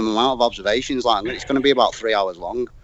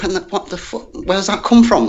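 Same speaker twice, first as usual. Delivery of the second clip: higher, retold outburst of disbelief; shocked disbelief; 8 semitones higher, 4 dB louder than usual. Retelling his reaction to a mate's question, higher and disbelieving. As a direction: act floored by a stupid question. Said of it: "I was like".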